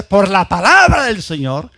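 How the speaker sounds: "Por la palabra del Señor" loud, with "palabra" stressed; contrasting emphasis. Shown as a demonstration. Preacher sets the two verses side by side, stressing "palabra". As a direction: lean hard on "palabra". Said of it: "Quiero que ustedes vean el contraste".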